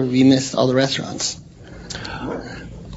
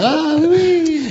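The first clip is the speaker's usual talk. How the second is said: much higher, slow, hammed-up oui oui; mock French reply; much slower than usual. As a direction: drag out the oui like an eager local. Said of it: "and they go".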